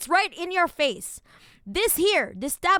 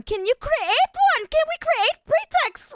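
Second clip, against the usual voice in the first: mock outrage; 6 dB louder than usual. Mocking the document's question.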